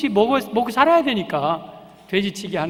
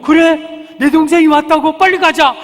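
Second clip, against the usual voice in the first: loud, high, excited reaction; eager welcome; 7 semitones higher, 9 dB louder than usual. Acted. Brother hears his sibling is back, eager welcome. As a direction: burst out, keen to go and greet him. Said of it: "반겨줄 것 같은데".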